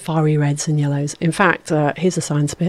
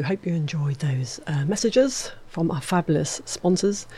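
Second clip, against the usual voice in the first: whisper; 6 dB quieter than usual. Acted. Radio host jokes after ads.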